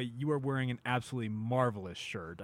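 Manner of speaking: ordinary delivery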